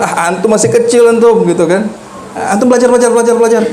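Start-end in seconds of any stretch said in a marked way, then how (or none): 0.0-1.5 mock scolding teacher. 2.3-3.6 mock scolding teacher.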